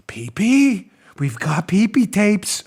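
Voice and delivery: slow, secretive whisper; mock whisper. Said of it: "mock secret".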